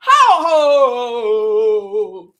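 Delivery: loud mock hoots. Mock outrage.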